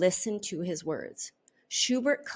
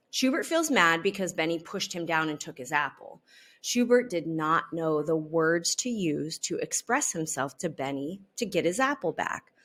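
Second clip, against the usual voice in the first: usual speaking voice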